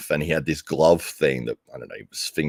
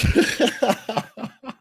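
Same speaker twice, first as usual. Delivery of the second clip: long high laugh; a long high-pitched laugh; high drawn-out laugh. Real, not acted. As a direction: laugh hard at the twist. Laughs at the irony.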